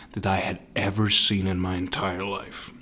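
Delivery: ordinary talk, nothing marked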